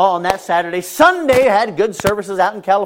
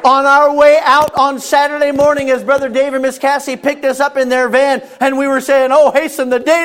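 higher, louder, slowed preaching cadence; preaching at full force; 10 semitones higher, 7 dB louder than usual. Acted. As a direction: preach it with building fervor.

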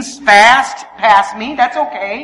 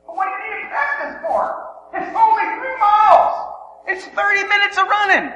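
high-pitched incredulous protest; scoffing disbelief; 9 semitones higher, 4 dB quieter than usual. Retold or imitated. Husband dismissing wife's plan to practice running, scoffing disbelief.

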